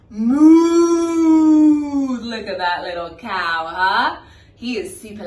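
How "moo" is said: loud cow moo. Retold or imitated. Teacher voicing the cow for kids, loud moo.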